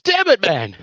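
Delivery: high, loud frustrated outburst; mock exasperation. Imitating Mike beaten to the message, loud exasperation. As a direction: burst out in comic frustration. Retelling Mike's frustrated reply.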